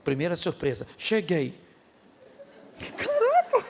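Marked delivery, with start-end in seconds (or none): 3.0-3.6 high, laughing exclamation of surprise; surprised. Pretend. Arriving in heaven amazed to be there, surprised laughing cry. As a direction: gasp it out amazed.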